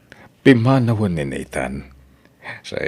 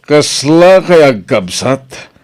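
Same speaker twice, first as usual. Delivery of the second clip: loud, high, slow pleading with father; 6 semitones higher, 9 dB louder than usual. Acted. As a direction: begs the parents loudly. Father pleads with angry parents. (malakas a pakpakaasi)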